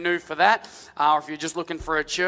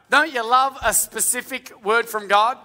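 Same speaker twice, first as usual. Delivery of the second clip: ordinary delivery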